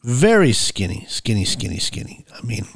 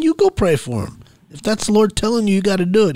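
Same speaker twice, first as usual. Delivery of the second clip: high-pitched, insistent comeback; 10 semitones higher than usual. Retold or imitated. Man tells his friend it's his job, insistent. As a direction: hand the task straight back, pitch up. Retold argument, handing task back.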